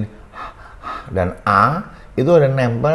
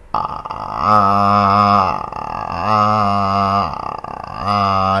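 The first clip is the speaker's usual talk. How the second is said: held vowel demo; much slower than usual. Shown as a demonstration. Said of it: "itu gitu".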